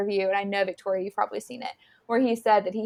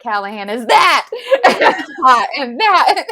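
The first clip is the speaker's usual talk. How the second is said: yelled capitalized words, higher and louder; mock yelling; 3 semitones higher, 9 dB louder than usual. Retelling the actor yelling the capitalized words, laughing. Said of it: "he was like".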